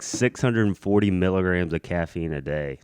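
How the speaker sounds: ordinary talk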